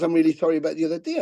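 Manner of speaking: rushed, sheepish sorry; fast mumbled apology from a fan; quick apology. Retold or imitated. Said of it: "the guy comes back to me and he goes".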